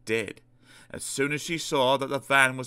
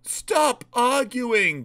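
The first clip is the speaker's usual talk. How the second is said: raised, drawn-out complaint; grumbling; 13 semitones higher, 5 dB louder, much slower than usual. A narrator's reading. Passengers fed up with the argument, grumbling. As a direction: grumble loudly, stretching the words. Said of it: "grumbled the passengers".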